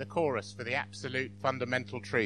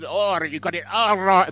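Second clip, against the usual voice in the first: loud, badly faked Russian; failed Russian accent; 3 semitones higher, 8 dB louder than usual. Joke's Russian answering the interviewer, badly faked Russian accent. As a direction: attempt a stage Russian and miss. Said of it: "and the Russian says".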